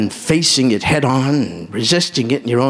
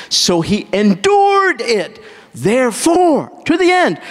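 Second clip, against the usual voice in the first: preaching climax; 8 semitones higher than usual. Real, not acted. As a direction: lift it higher and higher to the end.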